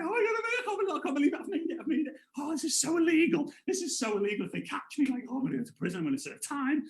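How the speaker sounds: high-pitched, hammed-up panic; mock freaking out. Boy fearing prison for sneaking into film, comic panic. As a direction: babble in a high mock fluster. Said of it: "So I'm, like, freaking out".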